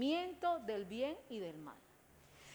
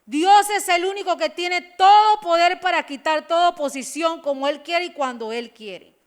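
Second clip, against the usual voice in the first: fiery preaching; 3 semitones higher, 11 dB louder than usual. Acted. Preacher exhorting the congregation, loud and fired up. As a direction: preach it out to the whole church.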